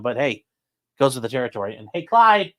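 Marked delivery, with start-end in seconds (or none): 1.9-2.5 calling a pet. Real, not acted. Calling the cat over.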